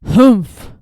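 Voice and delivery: a loud, grumpy humph; sniffy kangaroo humph; gruff humph. Acted. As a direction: huff it out in disapproval. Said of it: "humphed a voice".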